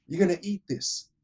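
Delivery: high, loud hammed-up threat; a pumped-up dad ordering his kid; mock angry father. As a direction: lean in and lay down the law. Father forcing daughter in retold scene.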